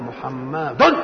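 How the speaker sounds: held-back "محمد" with its last syllable popped out; rushed word ending. Acted. Boy making up for time spent recalling the rule, rushed ending. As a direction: draw out "محمد", then blurt the final "dun". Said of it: "ولذلك ينطقها بسرعة".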